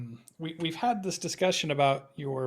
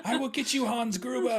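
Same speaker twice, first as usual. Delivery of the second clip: put-on kid voice; 8 semitones higher than usual. Kid playing Die Hard.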